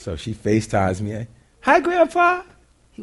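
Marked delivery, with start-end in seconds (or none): 1.6-2.4 put-on much higher, sweet little boy greeting. Grandson greeting grandpa on FaceTime, in a high little voice. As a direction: pipe up like a four-year-old.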